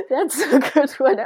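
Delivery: laughing loud and high through the words; real laughter over the punchline. Real, not acted. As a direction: crack up while you say it. Host laughing at the story.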